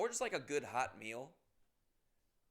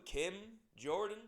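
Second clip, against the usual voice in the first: ordinary delivery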